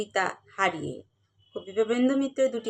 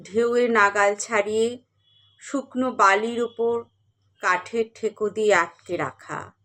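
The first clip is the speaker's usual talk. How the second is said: measured, expressive verse reading with long pauses; dramatic recital with rising and falling cadences; poetry recitation; 4 dB louder than usual. Acted. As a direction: recite the poem phrase by phrase, letting line endings sink. Recites a poem.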